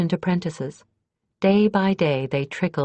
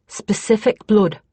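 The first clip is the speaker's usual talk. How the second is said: tight, loud, raised words of held-back anger; smouldering anger; 6 semitones higher, 6 dB louder than usual. A narrator's reading. Kyra to Kethri about the killers, smouldering anger. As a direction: let the old grudge burn through. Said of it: "with a smouldering anger Justin shared".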